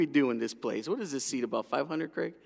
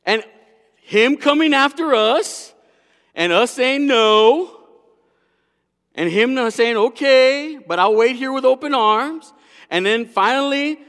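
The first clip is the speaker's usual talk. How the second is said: loud, high, slow proclamation; full-force preaching; 12 semitones higher, 13 dB louder, slower than usual. Real, not acted. Preacher tells congregation of God's love, loud impassioned preaching. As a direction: proclaim it loud, stretching the words.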